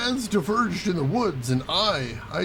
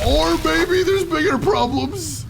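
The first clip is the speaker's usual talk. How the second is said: alarmed surprise; 11 semitones higher, slower than usual. Real, not acted. Spots a giant spider.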